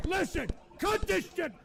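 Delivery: fed-up shout at the callers; angry shout. Real, not acted. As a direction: yell at them to stop. Streamer yells at the trolls.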